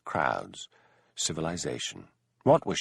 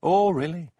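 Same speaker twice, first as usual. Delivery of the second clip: surprised; 9 semitones higher, 5 dB louder than usual. Acted. Elspeth teasing Hamish.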